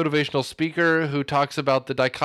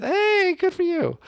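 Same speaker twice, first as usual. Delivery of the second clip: much higher congratulatory voice; high-pitched cheer. Real, not acted. Co-host congratulating the others on the name, high cheer.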